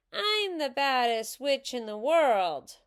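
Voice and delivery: high, loud yell with a falling end; big, high-pitched holler; yelled boast. A narrator's reading. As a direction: yell it out like a gleeful little menace. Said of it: "yelled Ramona".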